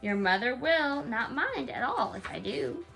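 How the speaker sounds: plain reading voice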